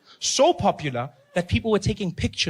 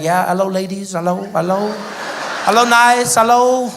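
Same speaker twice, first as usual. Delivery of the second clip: louder, flirty greetings; posing at gallery; 3 semitones higher, 7 dB louder than usual. Pretend. Posing beside his portrait, greeting ladies, louder. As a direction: call out to the ladies like a showman. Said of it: "We'd be like".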